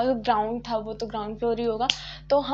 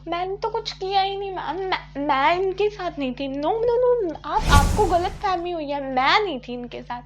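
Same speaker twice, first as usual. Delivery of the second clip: higher, pleading I-wasn't-there voice; clearly higher, protesting no no to the teacher; innocent denial; 5 semitones higher, 3 dB louder than usual. Retold or imitated. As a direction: play the wide-eyed innocent talking her way out. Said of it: "मैंने कुछ ऐसे वाला expression दिया".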